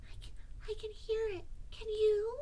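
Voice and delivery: high, hesitant little voice; anxious. A narrator's reading. Piglet nearing the trap, high anxious squeak. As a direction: stammer nervously in a small high pitch. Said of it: "said Piglet anxiously".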